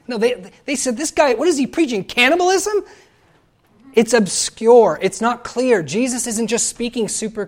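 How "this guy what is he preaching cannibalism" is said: high, rising disbelief; incredulous outrage. Retold or imitated. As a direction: climb louder and higher to an astonished question. Listeners appalled at Jesus' words, retold.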